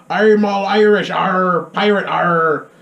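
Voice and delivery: loud, piratey mock Irish brogue; mock Irish accent. Reviewer mocking the actor's new Irish accent, hammed-up pirate Irish. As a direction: exaggerate Irish into a pirate's "arr". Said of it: "now he's like".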